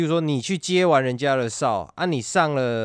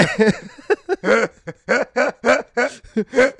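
big high burst of laughter; loud laugh; 11 semitones higher, 6 dB louder than usual. Real, not acted. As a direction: crack up loudly. Laughs after the joke.

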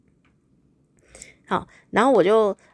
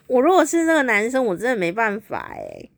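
ordinary talk

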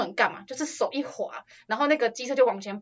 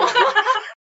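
short high laugh, falling; 12 semitones higher, 4 dB louder than usual. Real, not acted. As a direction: laugh at the memory. Laughing after the aunt's scolding. (短促的高声笑)

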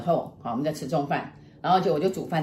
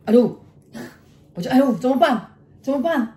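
higher, louder put-on panic; mock alarm; 6 semitones higher, 5 dB louder than usual. Wife scaring her husband in the car, mock alarm. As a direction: cry out in pretend dismay.

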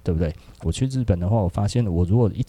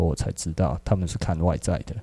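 usual speaking voice

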